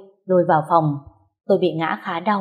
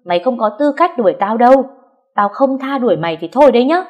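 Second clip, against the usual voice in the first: higher, louder defiant retort; angry threat; 5 semitones higher, 5 dB louder than usual. A narrator's reading. Narrator voices sister-in-law threatening the wife, angry. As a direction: throw it back at her heatedly.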